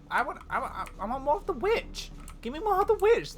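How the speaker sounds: ordinary delivery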